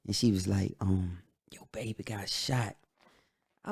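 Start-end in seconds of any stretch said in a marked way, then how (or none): 1.5-2.8 imitating a grandma, softly telling it; subdued bad news. Grandma telling Larry of the shooting, subdued. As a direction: break it softly, without raising the pitch.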